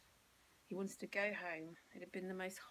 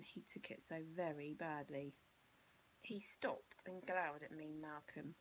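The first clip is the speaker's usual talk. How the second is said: ordinary delivery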